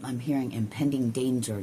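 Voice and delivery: ordinary delivery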